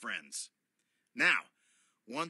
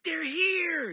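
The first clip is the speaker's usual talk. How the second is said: high, loud happy yell; excited yell; 9 dB louder than usual. Acted. Pom Pom yelling as friends arrive. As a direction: shout it out with joy. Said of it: "yelled Pom Pom".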